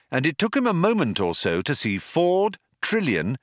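plain reading voice

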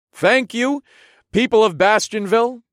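loud, high call to the crowd; loud announcement. A narrator's reading. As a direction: project it to the whole crowd. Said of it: "I announced in a loud voice".

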